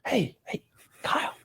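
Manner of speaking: hushed, secretive stage whisper; furtive whisper. Acted. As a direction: whisper as if sneaking a question to someone. Guard furtively recognizing him, acted out.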